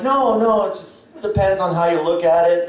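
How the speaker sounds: high, mock-kind consolation; imitating a sympathetic audience. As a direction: play a kind stranger reassuring him. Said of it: "I was looking for a sympathy".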